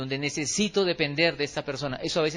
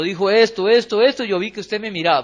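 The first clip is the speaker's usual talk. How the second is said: louder mock accusation; 3 semitones higher, 8 dB louder than usual. Preacher acts offended church member.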